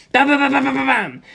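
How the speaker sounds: loud bam bam bursts; mouthed rapid gunfire; gun volley noise. A narrator's reading. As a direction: punch out each shot.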